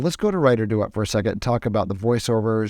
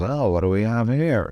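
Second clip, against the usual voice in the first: mock surprise with a swooping, sarcastic tune. Shown as a demonstration. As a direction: play up the sarcasm on the line. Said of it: "That's how he delivers this line".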